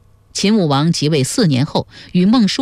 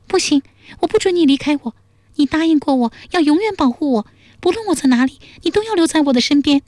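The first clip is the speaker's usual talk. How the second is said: high, fast princess voice, forbidding departure; quick, high-pitched royal demand to stay; possessive princess; 11 semitones higher, faster than usual. A narrator's reading. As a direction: voice an insistent princess, pitched far up. Princess forbids him to leave.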